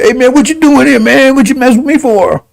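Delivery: high mock voice of a cornered man; mocking Farrakhan. Preacher mimicking Farrakhan confronted by the covenant, high and mocking. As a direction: lampoon him, pitched high. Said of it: "And then Farrakhan said".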